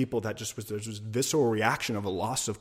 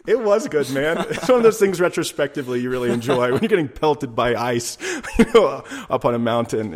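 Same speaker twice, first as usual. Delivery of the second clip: laughing; 4 semitones higher, 10 dB louder than usual. Real, not acted. Laughing about hailstorm on mountain.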